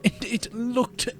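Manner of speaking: slow, eerie creepypasta narrator; spooky storyteller voice. Acted. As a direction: tell it like a ghost story by candlelight.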